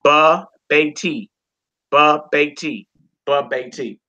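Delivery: ba, be, ti chanted as separate beats; syllable-by-syllable reading. Shown as a demonstration. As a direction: tap out each syllable ba, be, ti. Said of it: "We would say".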